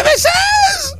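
excited greeting. Retold or imitated. Host imitating Vernon excitedly greeting him. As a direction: yell it with glee. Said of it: "He gets all excited like that".

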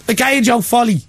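put-on "La Cage aux Folies" in a high, comic Irish accent; hammed-up Irish take on "La Cage aux Folies"; mock Irish accent. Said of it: "as Graham would pronounce it".

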